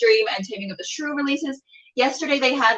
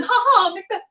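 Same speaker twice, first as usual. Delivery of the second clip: mock laugh; 10 semitones higher than usual. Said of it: "not hilarious as in".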